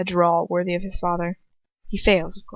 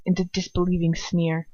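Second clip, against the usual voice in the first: ordinary delivery, nothing marked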